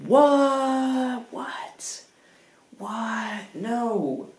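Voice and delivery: soft, drawn-out high "what"; feigned disbelief. Acted. Bluffing Ellie that Tony isn't dead, feigned surprise. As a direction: deny it with exaggerated innocence.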